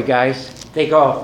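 usual speaking voice

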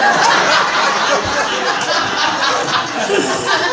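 long, high burst of real laughter; a real rising belly laugh; big laugh. Real, not acted. Laughs at the irony.

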